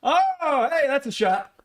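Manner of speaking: high, whooping reaction to a catch; excited outburst. Real, not acted. Co-host reacting to Kelce's catch, high excited shout. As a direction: erupt over the big play.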